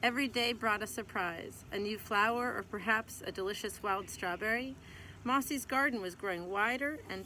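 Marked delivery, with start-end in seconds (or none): none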